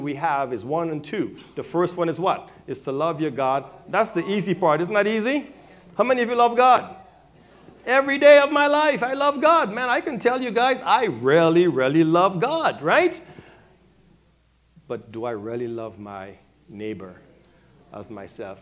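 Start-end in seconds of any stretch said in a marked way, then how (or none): 6.0-13.2 loud, high, fervent shout; preaching at full force. Real, not acted. Pastor rousing the congregation about loving God, loud fervent preaching. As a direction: belt it out to the congregation.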